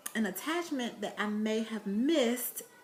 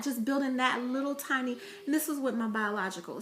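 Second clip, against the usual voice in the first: usual speaking voice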